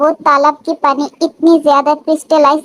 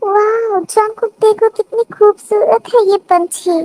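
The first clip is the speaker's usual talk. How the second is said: delighted wonder; 6 semitones higher than usual. Acted. Wife shows husband a beautiful bird, delighted wonder. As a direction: marvel in a lifted, girlish character voice.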